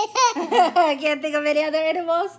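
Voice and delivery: high, loud laughing words. Real, not acted. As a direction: laugh through the line. Laughing mid-game.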